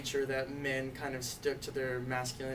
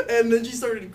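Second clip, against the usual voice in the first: laughing through the words; 8 semitones higher, 11 dB louder than usual. Real, not acted. Telling friends mom cried, laughing loudly. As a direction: giggle while telling it. Laughing retelling her crying.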